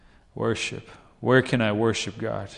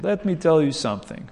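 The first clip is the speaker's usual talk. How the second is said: higher-pitched imitated Romanian accent; 6 semitones higher than usual. Pastor answers a congressman, imitated in a Romanian accent. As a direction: lightly put on a Romanian accent. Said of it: "with his accent, Romanian accent".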